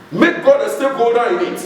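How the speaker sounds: firm sermon command, not yet at full cry; preacher's exhortation. Real, not acted. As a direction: urge the congregation in a high, firm voice. Preacher urges congregation, first take.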